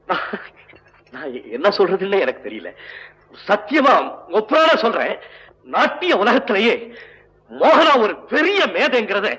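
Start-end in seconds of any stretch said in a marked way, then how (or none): 3.5-9.3 high, emphatic tribute to an audience; raised-pitch heartfelt speech with stretched words; fervent public declaration. Acted. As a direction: proclaim it high, phrase by phrase. Public declaration honouring the dancer.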